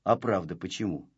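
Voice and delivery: falter, caught out for a moment; momentarily abashed. A narrator's reading. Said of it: "смутился на мгновение Незнайка".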